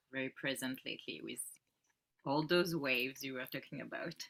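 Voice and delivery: ordinary talk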